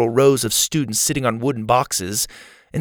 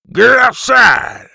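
high, slow, louder gruff roar; louder gruff shout; 13 semitones higher, 9 dB louder, slower than usual. Acted. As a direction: bellow it even harder, mouth full of marbles. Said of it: "the man repeated".

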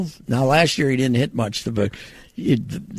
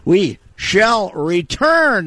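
radio sign-off; 6 semitones higher, 4 dB louder, much slower than usual. Real, not acted. Host signing off to break.

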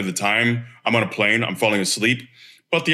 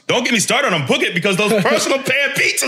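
high, gushing pizza nostalgia; excited; 11 semitones higher than usual. Real, not acted. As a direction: rave about it, half laughing. Gushing over Book It pizzas.

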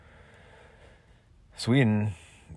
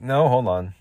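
usual speaking voice

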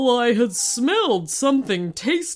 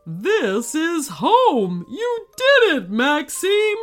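slow then much higher, louder cry; joyful; 6 semitones higher, 5 dB louder, slower than usual. Acted. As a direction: celebrate being home. Alexei delighted.

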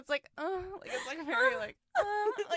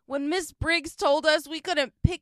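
loud, higher rallying speech; Sam's pep talk; 7 semitones higher, 8 dB louder than usual. Retold or imitated. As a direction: pump up the team like a brash captain. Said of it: "She's like".

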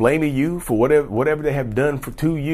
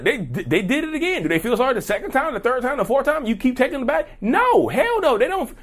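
high, incredulous ranting; worked-up high voice; exasperated rant; 10 semitones higher than usual. Real, not acted. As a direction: pile on the disbelief.

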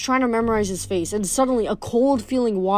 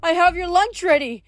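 high-pitched motherly call; calling after him; 8 semitones higher than usual. A narrator's reading. Mother calling after her departing son, high and pleading. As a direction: keep calling in a raised, high voice. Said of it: "May Castellan called after him".

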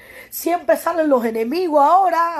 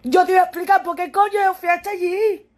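real crying; 4 semitones higher than usual. Real, not acted. To Ale who hurt her, breaking into real tears. Upset woman answering her critic.

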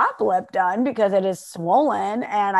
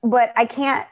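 ordinary delivery

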